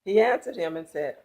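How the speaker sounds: ordinary delivery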